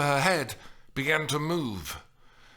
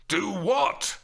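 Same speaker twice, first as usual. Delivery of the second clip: raised, indignant questioning shout; higher-pitched exclaimed question; shouted retort; 9 semitones higher, 4 dB louder than usual. A narrator's reading. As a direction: shout it back, baffled. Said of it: "he shouted back".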